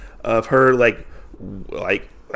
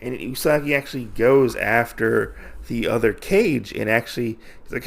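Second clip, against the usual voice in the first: normal talking voice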